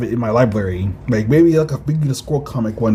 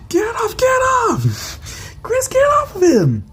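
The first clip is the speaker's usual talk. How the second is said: very high, slow frantic cry; a desperate high-pitched plea to save a friend; panicked yelling; much slower than usual. Acted. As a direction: beg for the creature to let go. Friend attacked by face hugger.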